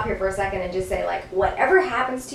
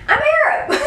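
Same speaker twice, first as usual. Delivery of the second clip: laughing; 5 dB louder than usual. Retold or imitated. Laughing retold reply.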